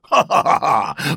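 loud hearty creature laugh; a monster laughing off fear. A narrator's reading. Creature laughs at the boy's question, loud laugh. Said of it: "und lachte".

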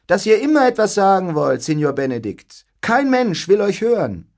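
high, loud and needling; teasing jab. A narrator's reading. Beatrice needles Benedikt before everyone, high and loud. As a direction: Beatrice takes a sharp little dig. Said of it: "stichelte los".

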